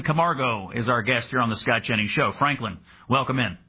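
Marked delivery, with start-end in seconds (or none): none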